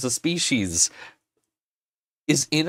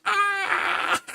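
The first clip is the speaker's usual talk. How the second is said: high, teary cry of overwhelm; sobbing wail. Real, not acted. Maker overwhelmed with emotion, high teary cry. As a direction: let out a crying wail. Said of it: "I was a ball of panic".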